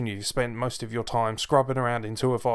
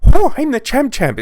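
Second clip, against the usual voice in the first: shrill, much higher, shouted stage-Irish voice; leprechaun Becky Lynch accent; 14 semitones higher, 14 dB louder than usual. Retold or imitated. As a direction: ham up an over-the-top Irish leprechaun. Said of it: "her Irish accent is just".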